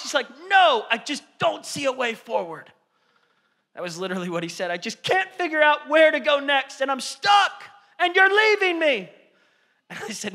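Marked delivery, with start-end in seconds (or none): 0.5-2.7 put-on child voice. Son voiced. 4.7-9.1 put-on high, loud child voice; panicked son. Imitating his panicked son stuck on the rocks.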